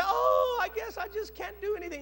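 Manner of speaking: high, whiny give-up voice; mock defeated devil. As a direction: sigh like a beaten devil. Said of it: "he doesn't give up on you and say".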